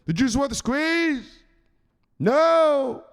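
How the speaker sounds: high, loud barked demand; shouted enforcer drill. Acted. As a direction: drill him like a sergeant.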